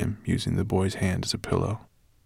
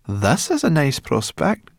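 groaning; 8 semitones higher, 5 dB louder than usual. Acted. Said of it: "groaned Sorrel".

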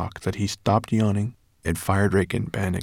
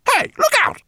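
very high squeaky rat yell; shrill squeak; 6 dB louder than usual. A narrator's reading. Rat pilot yelling at the clumsy professor, shrill squeak. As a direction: squeal a warning like a tiny rat. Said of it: "squeaked Lola shrilly".

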